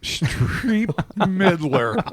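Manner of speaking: slow, laughing drawl. Real, not acted. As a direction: stretch the name out laughing. Jokey name echo.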